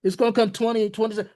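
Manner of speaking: high, fast mock of a doubter; mocking skeptic. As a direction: rattle it off as a silly naysayer.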